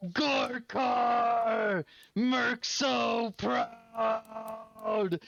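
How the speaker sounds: nada tinggi dan lantang, logat kasar; Merc's booming voice. Acted. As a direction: bellow it proudly like a big brute warrior. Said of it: "you hear Merc's voice go".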